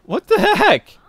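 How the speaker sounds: high, loud yelp of disbelief; startled outburst. Real, not acted. Player reacting to the game, high startled outburst. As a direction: exclaim loudly, pitch shooting up.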